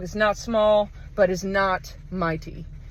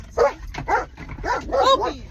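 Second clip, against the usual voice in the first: high, sharp call to the dog; 12 semitones higher, much slower than usual. Retold or imitated. Calling her dog away from the other dog, high call.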